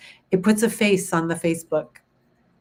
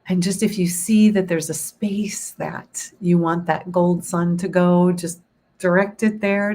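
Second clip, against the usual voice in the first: guided meditation. Acted. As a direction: guide them gently into calm.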